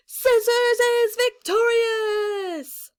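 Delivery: slow, high, loud victory call; triumphant announcer. A narrator's reading. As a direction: proclaim the winner like a ring announcer.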